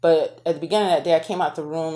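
ordinary delivery

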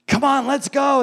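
lightly acted impatient order; exasperated Balaam. Balaam urging on the donkey he beats, lightly acted exasperation. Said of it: "He's like".

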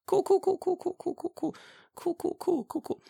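very high, fading cool cool cool; awkward cool cool. Retold or imitated. Reacting to a guy from Tokyo with very high, fading cools. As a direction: squeak out a nervous string of cools.

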